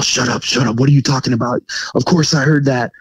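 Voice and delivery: retold alarmed snapping. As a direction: imitate a rattled wife shushing her husband.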